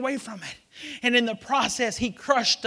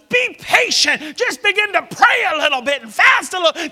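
full-force preaching; 6 semitones higher, 10 dB louder than usual. Acted. Preacher voicing the Lord to the congregation, shouting. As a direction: thunder the Lord's words out.

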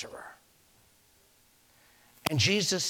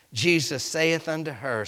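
normal talking voice

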